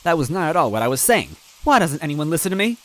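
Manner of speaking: nobody ever listens to me; frustrated. A narrator's reading. Luigi's frustrated line.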